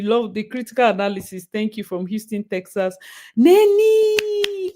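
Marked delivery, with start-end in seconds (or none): 3.4-4.7 high, loud, long-held name call; delighted shout. Real, not acted. Streamer spotting Nenny among the supporters, delighted shout.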